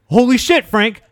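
high, loud cry of disbelief; shocked outburst. Real, not acted. Telling Frank about the dead Iron Man rumour, a loud, high outburst. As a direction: blurt it out like the news just hit.

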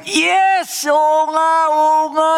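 overjoyed. A narrator's reading. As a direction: burst out with glee. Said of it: "Tuwan-tuwa siya". (tuwang-tuwang sigaw)

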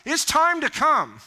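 high, loud, insistent call; urgent summons. Acted. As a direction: summon them urgently, building in force. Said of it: "he's saying it like that".

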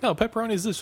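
high-pitched, quick retold correction; group correcting someone. Four people on the boat correcting one guy, high retold objection. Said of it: "Four of them are like".

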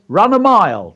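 performance-poem punch; emphatic dragon line. Shown as a demonstration. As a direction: call it out like a dragon's order. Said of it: "So the emphasis is on".

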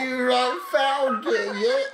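high, angry British squawk; hammed-up furious British schoolboy; Harry Potter. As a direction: squeal the insult in mock British. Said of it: "You sound like the most angry Harry Potter".

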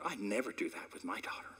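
a teasing whispered protest; mock whisper denial. Preacher teasing mothers, whispered mock innocence.

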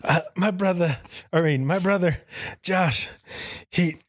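high, halting mock deathbed confession; hammed-up movie death scene; dying soldier. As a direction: plead softheartedly as a dying brother. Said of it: "you held him in your arms as he died".

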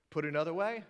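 ordinary delivery

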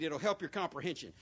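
usual speaking voice

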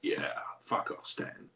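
low, gravelly growl, brushing off Stan; rough, dismissive tough-guy grumble; gravelly Jack voice. Retold or imitated. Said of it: "my Jack would be gravelly".

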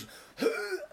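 high, audible wheezing breath; imitated heavy breath. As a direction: heave out a noisy breath. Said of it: "like I breathed heavily".

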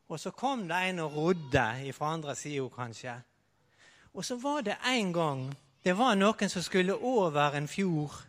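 usual speaking voice

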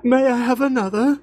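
high mock British plea; British accent. Host playing along with the begging bit, high mock British accent. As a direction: ask in a hammed-up British accent. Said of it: "Please give us more accent".

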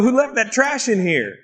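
high-pitched mock complaint; mock indignation. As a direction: act affronted at finding junk. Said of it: "kick it to the side and go".

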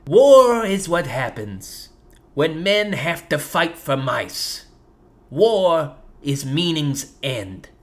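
slow, solemn poem reading; grave, unhurried haiku; haiku recital. Acted. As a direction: recite it slowly, weighing each line. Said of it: "I told them both a haiku".

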